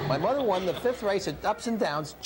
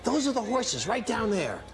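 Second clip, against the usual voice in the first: ordinary talk, nothing marked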